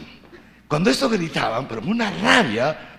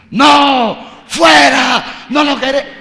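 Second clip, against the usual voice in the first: loud, high furious chant of a mob; angry crowd shouting; 7 semitones higher, 9 dB louder, much slower than usual. Retold or imitated. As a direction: shout them down as an outraged crowd. Angry crowd shouting at new priest.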